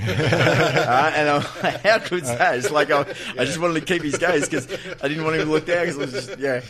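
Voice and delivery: laughing. Real, not acted. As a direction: crack up while telling it. Laughs at the Foreman story.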